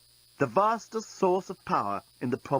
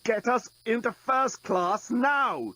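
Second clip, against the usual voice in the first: higher, slow, strained demand; choked demand; 10 semitones higher, slower than usual. A narrator's reading. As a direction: force the order out through a choke. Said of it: "choked the journalist".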